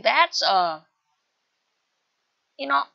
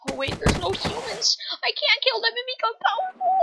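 very high-pitched wail of dismay; squealed despair; panicked realisation; 12 semitones higher than usual. Acted. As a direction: spiral into a high, hurried panic.

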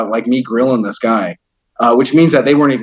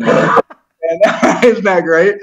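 slow, laughing through the words; 3 semitones higher, much slower than usual. Real, not acted. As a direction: laugh it out, savoring it. Laughing at triggering folks.

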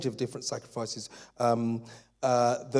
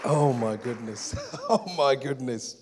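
laughing. Real, not acted.

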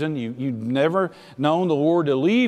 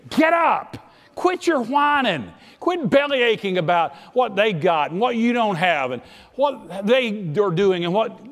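much higher, sharp scolding; a hint of a stern father; scolding voice of God; 8 semitones higher, 3 dB louder than usual. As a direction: cut off the whining, firmly.

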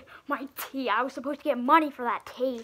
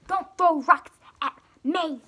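shout; 3 semitones higher, 9 dB louder than usual. Acted. Protesting being hit by rocks.

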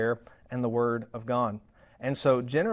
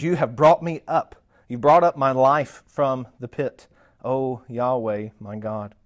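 scripture reading; 7 dB louder than usual. Acted. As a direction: proclaim it strongly, then ease down reverently.

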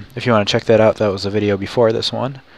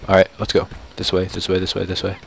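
normal talking voice

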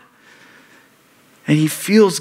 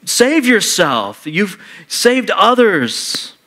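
higher, slow, drawn-out scoff; scoffing leaders; 7 semitones higher, slower than usual. Retold or imitated. Religious leaders jeering at Jesus, slow raised scoff. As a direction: lean on each taunt slowly. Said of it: "the religious leaders are saying".

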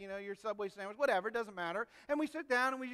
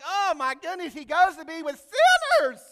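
mock outrage; 7 semitones higher, 13 dB louder than usual. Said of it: "they're like".

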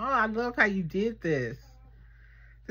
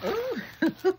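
delighted ooh; 13 semitones higher than usual. Real, not acted. Delighted unboxing squeal.

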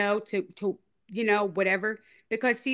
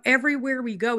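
normal talking voice

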